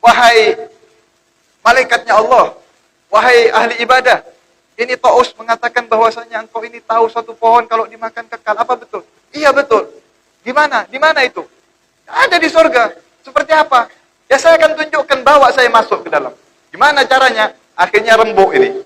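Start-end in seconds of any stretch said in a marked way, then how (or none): 0.0-9.1 lightly voiced fawning caller, higher; a hint of a wheedling creature; flattering snake. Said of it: "Lalu ditanya". 9.3-17.5 high, eager back-and-forth voices; bargaining voices. Acted. Quick dialogue between devil and animals.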